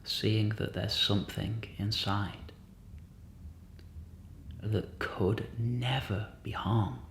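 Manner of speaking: steady, even meditation voice with long pauses; guided meditation. Real, not acted.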